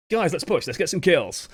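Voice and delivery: upbeat, into-it shot call; keen, friendly urging; open and friendly. Shown as a demonstration. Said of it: "sound open and friendly and".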